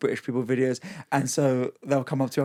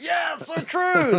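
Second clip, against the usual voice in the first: mock agreeing crowd; slower than usual. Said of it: "And everyone's like".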